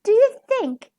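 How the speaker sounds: high, hesitant wolf voice; shy. A narrator's reading. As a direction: ask timidly, hoping for approval. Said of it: "asked Big Bad Wolf shyly".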